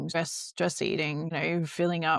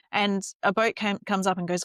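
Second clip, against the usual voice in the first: usual speaking voice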